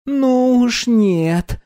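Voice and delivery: high, slow disdainful snort; scornful. Acted. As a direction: drawl it out with contempt. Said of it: "презрительно фыркнула".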